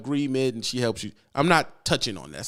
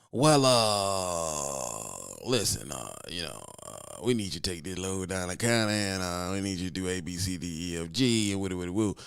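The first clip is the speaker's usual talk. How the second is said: dispatcher voice; 7 semitones lower, 5 dB quieter than usual. Acted. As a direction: play the dispatcher pushing a load on you. Dispatcher voiced giving load orders.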